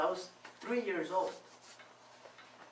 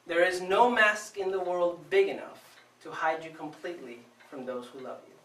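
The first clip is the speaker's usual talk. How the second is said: normal talking voice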